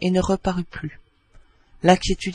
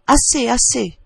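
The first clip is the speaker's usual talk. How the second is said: raised, higher-pitched mock plea; vibrant cry; 5 semitones higher, 4 dB louder than usual. A narrator's reading. Joker calling to the upstairs room, in vibrant voice. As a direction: call out with ringing urgency. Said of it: "il poussait d'une voix vibrante".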